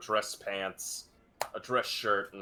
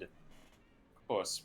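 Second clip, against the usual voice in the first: normal talking voice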